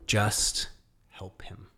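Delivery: whispered to yourself; thinking to yourself before the client, whispered; inner whisper. Retold or imitated. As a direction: breathe it as a private reminder. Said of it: "I remember thinking to myself".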